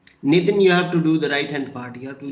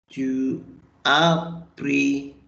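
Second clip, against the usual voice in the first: normal talking voice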